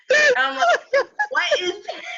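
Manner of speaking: high, loud laughing yell; shocked shriek. Retold or imitated. Student first sees the jawless dog, loud high laughing shriek.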